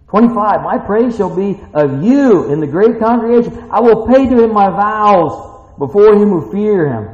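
loud, high preaching read, slowing at the end; full-force pulpit reading of the verse; preaching scripture. Acted. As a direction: proclaim the psalm to the congregation. Reads the psalm at full force.

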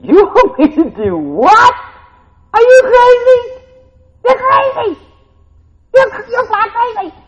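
high, loud, disbelieving yelling; incredulous outburst. Acted. Man protesting loudly to God, incredulous shout. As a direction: protest to God like he's lost his mind. Acted protest at God's request.

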